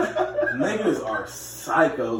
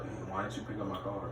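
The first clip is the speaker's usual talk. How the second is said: very soft, low, meek question; lowered voice; 7 semitones lower, 16 dB quieter than usual. Acted. Man told off by girlfriend asks about his call, lowered voice. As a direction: ask it timidly under your breath. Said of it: "First of all, lower your voice".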